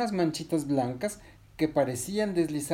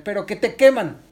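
high, loud punchline; raised, emphatic storybook warning; 9 semitones higher, 10 dB louder than usual. Acted. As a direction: hit the danger hard. Resbalardilla reveals the danger.

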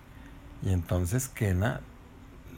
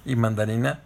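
usual speaking voice

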